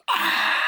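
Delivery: short high, loud scream; high-pitched acted yelp of shock; imitated scream. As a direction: shriek in mock horror. Jennifer screams on looking in.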